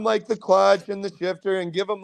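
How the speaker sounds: ordinary delivery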